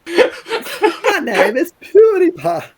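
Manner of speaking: put-on PewDiePie Swedish accent. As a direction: squeal it out in a hammed-up Swedish accent, laughing. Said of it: "Do it in the PewDiePie Swedish accent".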